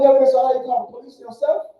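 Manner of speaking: normal talking voice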